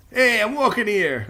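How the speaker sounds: loud, high New York street yell; brash New Jersey-New York accent, drawn out. Shown as a demonstration. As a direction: shout it like an indignant New Yorker. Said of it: "New Jersey, uh, New York style accent".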